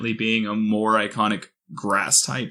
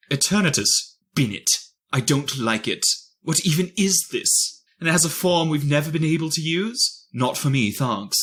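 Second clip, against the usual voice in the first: put-on reading in a British accent; 3 semitones higher than usual. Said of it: "read it in a British accent".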